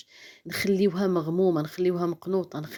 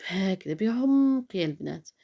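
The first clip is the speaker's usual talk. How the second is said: deep breath demo. Shown as a demonstration. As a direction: sigh out a deep breath then speak slowly. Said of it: "تنفسي بعمق".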